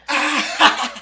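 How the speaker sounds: high loud laughing jeer; mocking laugh shout. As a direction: point and laugh at him. Laughing and pointing at loser.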